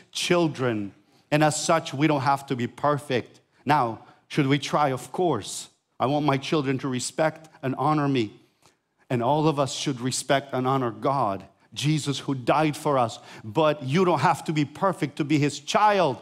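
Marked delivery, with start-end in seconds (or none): none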